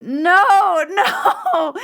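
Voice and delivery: high, loud laughing shout of no. Retold or imitated. Hearing the client's news, laughing high and loud. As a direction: burst out laughing no in disbelief. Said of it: "And I was like".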